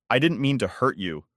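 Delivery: plain reading voice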